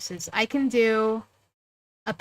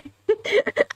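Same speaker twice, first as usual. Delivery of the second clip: high giggle after a failed voice; embarrassed laugh; 11 semitones higher than usual. Real, not acted. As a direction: laugh at your own attempt. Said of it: "I don't know what that was".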